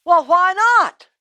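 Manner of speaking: high, loud, drawn-out challenge; indignant protest. Acted. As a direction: demand a reason, affronted. Rich man protests the refusal.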